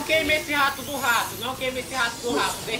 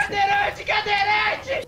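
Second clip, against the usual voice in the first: high drawn-out cry of alarm; 8 semitones higher, 3 dB louder, much slower than usual. Real, not acted. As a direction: cry out as it comes near. Monster approaching in game.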